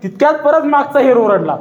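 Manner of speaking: ordinary delivery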